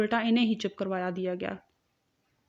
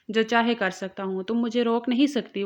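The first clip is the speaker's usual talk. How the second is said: plain reading voice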